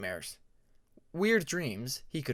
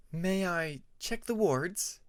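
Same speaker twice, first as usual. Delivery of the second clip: higher, slow, gentle dragoness asking; Tempest's voice; 5 semitones higher, slower than usual. A narrator's reading.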